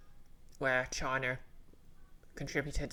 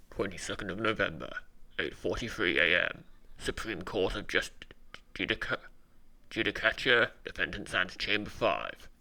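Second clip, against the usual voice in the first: deep, measured caption-reading voice; low, formal court announcer; 6 semitones lower than usual. Acted. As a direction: read the time and place low and deliberately. Restarts caption in put-on voice.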